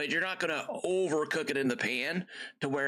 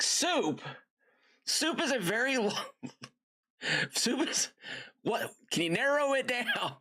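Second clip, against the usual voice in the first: much higher, mock disbelief at soup; incredulous dad; 10 semitones higher than usual. Dad retelling his reply to his daughter, mock incredulous. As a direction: squeak out disbelief at so vague an answer.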